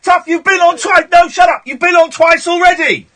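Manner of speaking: loud, high-pitched refusal; indignant, cutting off any argument; exasperated dismissal. Real, not acted. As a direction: shout the caller down. Host refuses the caller.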